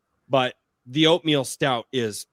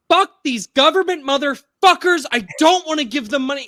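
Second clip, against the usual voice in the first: high, loud imitation of an upset wife; tearful angry rant; 11 semitones higher, 8 dB louder than usual. Retelling wife's phone call about license plate fees, tearful angry rant. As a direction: act out her outraged, worked-up yelling. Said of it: "And she's crying".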